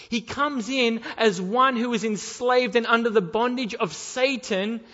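loud, pleading fire-and-brimstone preaching; full-force preaching. Acted. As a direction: press the congregation hard, loud.

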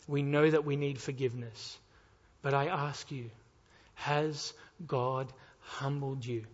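normal talking voice

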